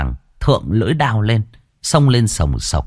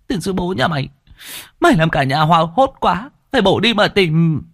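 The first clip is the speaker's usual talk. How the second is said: pitched-up scolding shout; relieved scolding; 9 semitones higher than usual. Acted. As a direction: scold him while half in tears. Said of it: "nhưng miệng vẫn quát".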